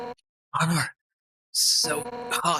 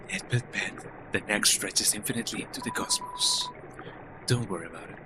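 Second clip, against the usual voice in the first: eerie river person; 3 semitones lower, 7 dB quieter than usual. Acted. Voicing the river person oddly.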